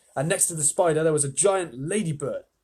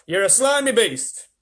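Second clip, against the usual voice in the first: higher, louder, slow insult; insulting centipede; 8 semitones higher, 8 dB louder than usual. Acted. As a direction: spit out the insult. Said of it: "answered the centipede".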